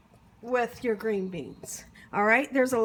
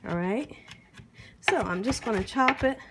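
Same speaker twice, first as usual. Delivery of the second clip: normal talking voice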